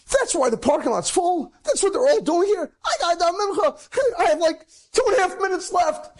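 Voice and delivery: high, breathless realization of being late; frantic panic. Retold or imitated. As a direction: race against the clock in a panic. Panicked, minutes before sunset.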